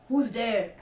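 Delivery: a touch of a deep voice; lightly voiced challenge from above. A narrator's reading. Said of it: "a deep voice above their heads said".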